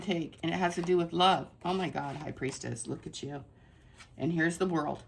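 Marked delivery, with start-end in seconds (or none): 1.6-3.4 softer, lower, hushed in wonder at the card; hushed awe. Real, not acted. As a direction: breathe it out in quiet amazement. Awed at a tarot card.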